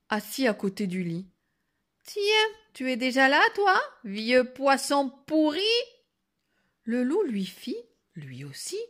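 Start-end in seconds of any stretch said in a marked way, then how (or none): 2.0-5.6 loud, higher, rude challenge; insolent girl. Acted. Girl spots the wolf by the bed, insolent. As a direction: throw the insult brashly.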